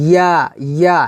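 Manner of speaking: ইয়া as one syllable, glide from the palate; correct ya. Shown as a demonstration. Teacher correcting learners, ইয়া with no leading i. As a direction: press mid-tongue to palate and say ইয়া as ya. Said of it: "তালুর সঙ্গে লাগিয়ে ইয়াটা উচ্চারণ করব".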